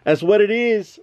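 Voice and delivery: normal talking voice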